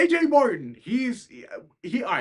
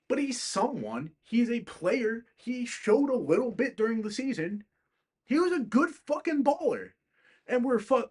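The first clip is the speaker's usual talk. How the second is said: heated defense; 7 semitones higher than usual. Real, not acted. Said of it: "I'm incredibly pissed off".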